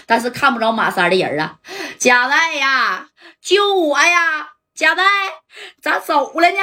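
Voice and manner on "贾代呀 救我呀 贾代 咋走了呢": drawn-out, slow, high cries for help; calling for help. Retold or imitated. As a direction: shout desperately after Jia Dai from out of sight. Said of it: "马三儿就喊呢". (拖长声音高喊救命)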